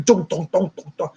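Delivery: steady vocal drum thumps; imitated drumbeat. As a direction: mimic a banging drum, thump after thump. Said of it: "he is literally banging a drum".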